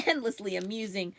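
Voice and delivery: plain reading voice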